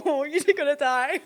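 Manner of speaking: cracking up while talking; high giggles breaking through the words; real laughter. Real, not acted. Storyteller cracks up before the phone-call punchline, laughing.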